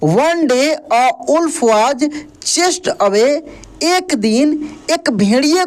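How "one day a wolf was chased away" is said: English read out very slowly. Shown as a demonstration. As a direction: read "One day a wolf was chased away" word by word, very slowly. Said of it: "तो यहाँ तक आप क्या पढ़े हैं अभी?".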